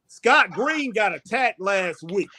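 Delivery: usual speaking voice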